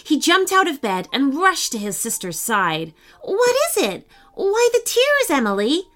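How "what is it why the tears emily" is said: put-on high-pitched, concerned child's questions. A narrator's reading. Sean rushes to his crying sister, concerned. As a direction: ask with sudden concern, voice lifted high. Brother worried at sister's tears.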